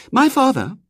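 put-on high, imperious girl's voice; a little girl giving orders; bossy little girl. A narrator's reading.

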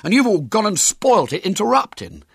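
put-on high, loud aggrieved boy; indignant high-pitched objection; protesting. A narrator's reading. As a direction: protest at being blamed. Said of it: "protested William".

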